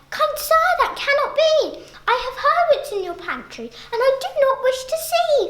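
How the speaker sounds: polite little fly. Acted. Little fly politely refusing the spider's pantry. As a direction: decline sweetly but firmly.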